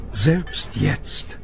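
usual speaking voice

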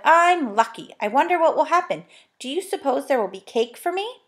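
put-on high-pitched delight of a thrilled girl; joyful. A narrator's reading. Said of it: "going red with joy".

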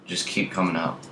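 ordinary talk

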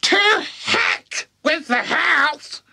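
very high, slow screech; shouting crone. Acted. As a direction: dismiss it with a screech. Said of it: "she shouted".

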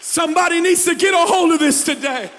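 loud, high shouted preaching; full-force preaching. Acted. Pastor voicing God answering the doubters, shouted preaching. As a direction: proclaim it with fire.

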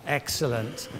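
ordinary talk

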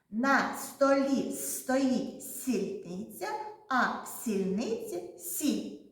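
slow, careful tongue-twister; slowly. Shown as a demonstration. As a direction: say each word slowly and clearly. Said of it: "спочатку повільно скажемо".

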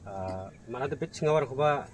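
ordinary talk, nothing marked

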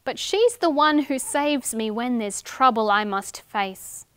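put-on lively sing-song reading to children; rhyme read aloud. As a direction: read the rhyme brightly to little ones. Reading a rhyming picture book.

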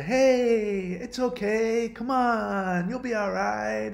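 sing-song pep talk to cheer someone up; mock soothing. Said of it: "other people were coming and trying to".

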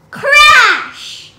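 high, loud crashing effect. A narrator's reading. Narrator voicing the beanstalk crashing down. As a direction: shout the crash.

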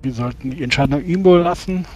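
normal talking voice

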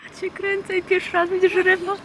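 high, loud excited squeal. Real, not acted. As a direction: squeal with delight. Excited at seeing fish close. (podekscytowany pisk)

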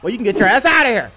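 fed-up shout at a player; angry ejection shout. Acted. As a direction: throw them out, loudly. Referee ejects an arguing player.

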